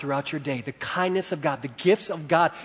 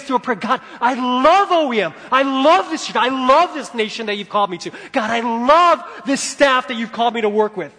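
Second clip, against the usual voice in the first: loud, high, driving sermon; preaching at full force; 7 semitones higher, 7 dB louder than usual. Real, not acted. Preacher urging church to love God's gifts, impassioned preaching. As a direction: proclaim it with rising fervour.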